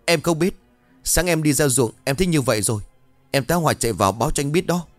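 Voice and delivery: high, hurried servant voice; flustered protest. A narrator's reading. Servant explains himself to angry Vinh, flustered protest. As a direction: plead ignorance, pitched well up.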